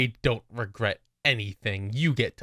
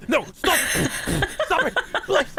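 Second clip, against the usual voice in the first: high, loud, hammed-up begging; mock pleading; 3 dB louder than usual. [name] begging Merrick to stop taunting, mock desperate pleading. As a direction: beg shrilly through the teasing.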